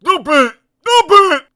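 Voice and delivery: very high, very loud stuffy cry. Acted. Elephant with knotted trunk, crying out. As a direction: yelp through a knotted trunk. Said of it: "cried the elephant".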